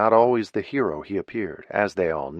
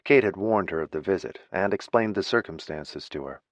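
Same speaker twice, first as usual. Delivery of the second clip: plain reading voice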